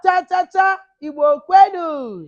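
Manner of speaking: high, very loud crowd call; rousing call for a group answer; rallying shout. Real, not acted. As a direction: shout the chant, stretching the last word. Rallying call, audience answers Yeah.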